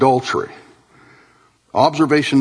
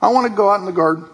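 mock giving up; 8 semitones higher than usual. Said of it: "throwing his hands up in the air".

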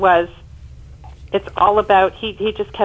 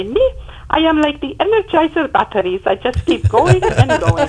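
higher-pitched imitation of mother's Dutch accent; little Dutch accent; 8 semitones higher than usual. Daughter quoting her mother's answer about cancer, little Dutch accent. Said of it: "in her little Dutch accent".

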